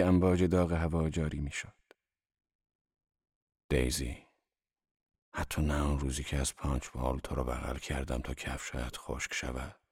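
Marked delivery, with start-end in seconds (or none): 3.7-9.8 low, soft, kindly appeal; dry but tender. A narrator's reading. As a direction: speak gently in a dry, lowered voice. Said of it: "صدایش خشک و در لحن کلامش مهربانی بود". (خشک اما مهربان، بم و آرام)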